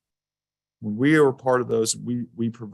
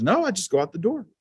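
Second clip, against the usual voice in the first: put-on little boy voice; 7 semitones higher than usual. Dad voicing son.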